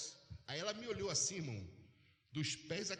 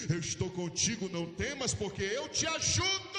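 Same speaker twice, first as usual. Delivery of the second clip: loud, fervent preaching that keeps building; preaching at full force; 10 dB louder than usual. Acted. Pastor preaching to the congregation, loud fervent climax. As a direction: shout it over the congregation like a revival.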